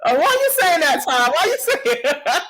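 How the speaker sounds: high-pitched laughing protest; mock protest. Real, not acted. Mock protest at being teased.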